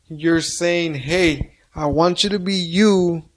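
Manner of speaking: ordinary talk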